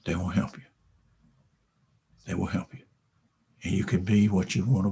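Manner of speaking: hushed heartfelt assurance; soft sincere. Real, not acted. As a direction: drop to an intimate promise. Sincere close to audience.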